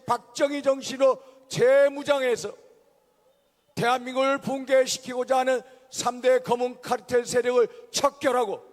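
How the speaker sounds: usual speaking voice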